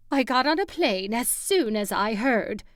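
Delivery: airy, rushed and breathy. Acted. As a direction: speak on a breathy, urgent exhale. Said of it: "she said in a breathy voice".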